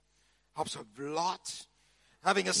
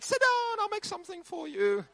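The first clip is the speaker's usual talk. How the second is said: a much higher, insistent mock host voice; imitating a bossy Italian host; 12 semitones higher than usual. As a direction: play the pushy relative waving him to sit. Strangers ordering him at breakfast.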